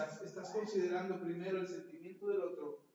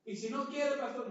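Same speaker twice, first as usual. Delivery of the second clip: higher, louder pleading complaint; whiny objection; 5 semitones higher, 4 dB louder than usual. Retold or imitated. Congregant objecting to the pastor, whiny. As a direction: whine a protest at the pastor.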